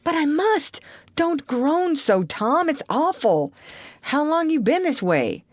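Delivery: put-on high, worried boy's voice; anxious. Sid alarmed at his groaning brother, worried pleading.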